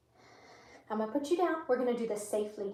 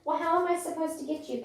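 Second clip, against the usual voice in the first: ordinary delivery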